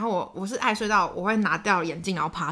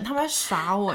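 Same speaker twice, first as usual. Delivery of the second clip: ordinary talk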